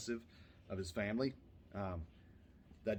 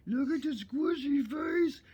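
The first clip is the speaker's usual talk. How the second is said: squeaky high, slow cooing at the puppy; 10 dB louder, slower than usual. Real, not acted. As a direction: gush over the squishy face in a tiny voice. Cooing at puppy, peak repeat.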